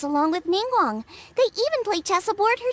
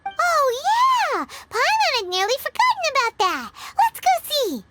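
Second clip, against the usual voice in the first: sudden excitement; 5 semitones higher than usual. Acted. Paimon suddenly remembers statue.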